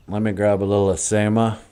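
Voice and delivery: ordinary talk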